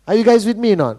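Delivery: normal talking voice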